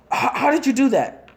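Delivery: stunned how he managed it; shocked question. Acted. Proctor asking Naruto how he did it, high and shocked. Proctor amazed after being cut.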